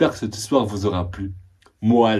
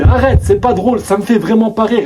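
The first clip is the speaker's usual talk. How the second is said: higher, louder retold scolding; raised, cross telling-off; 9 semitones higher, 6 dB louder than usual. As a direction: snap at them to stop. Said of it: "Je commence à embrouiller mes cousines".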